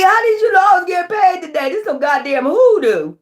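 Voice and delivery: hammed-up squeal of disbelief; shrill mock accusation at the billers; mock outraged. Hammed-up repeat of the question.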